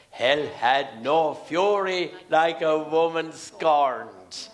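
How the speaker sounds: slow, low mock menace; menacing catchphrase. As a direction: imitate a panto baddie, drawing out each word. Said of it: "her menacing catchphrase was".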